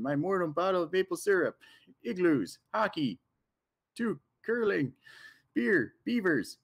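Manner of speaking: over-the-top Canadian caricature, eh; Canadian accent. Acted. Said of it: "That was the most Canadian thing ever, eh?".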